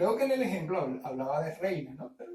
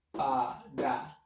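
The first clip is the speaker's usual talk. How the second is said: Paraíso said slowly, each syllable apart, the hiato on -raí-; split into syllables; 5 semitones lower, slower than usual. Shown as a demonstration. Teacher shows students how to split Paraíso, slowly by syllable. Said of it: "¿cómo se separa?".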